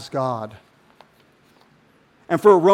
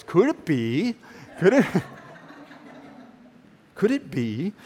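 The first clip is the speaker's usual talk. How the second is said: a hint of the Ancient Aliens host musing; ancient aliens guy voice; 6 dB quieter than usual. Preacher mimics the ancient aliens show, mock awe. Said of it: "say it in the ancient alien's voice".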